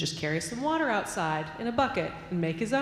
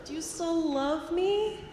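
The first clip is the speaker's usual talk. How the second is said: put-on anxious child; 8 semitones higher, slower than usual. Boy asks father anxiously.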